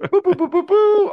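high burbling boo sounds; underwater voice. Pretend. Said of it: "I gotta sound like I'm underwater".

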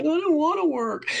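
put-on high, petulant child's complaint; a child's sulky protest, pitched high; whiny kid. Quoting her child self refusing.

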